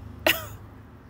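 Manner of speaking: high, airy disbelieving laugh; high breathy laugh. Real, not acted. Mom laughing about pizza from friends, overwhelmed. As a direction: laugh at how sweet it was.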